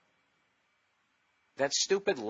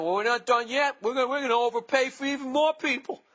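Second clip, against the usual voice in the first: higher, louder mock-smug voice; mocking Seattle boasting; 12 semitones higher, 5 dB louder than usual. Mocking Seattle's front office, hammed-up smug boast. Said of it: "they're saying".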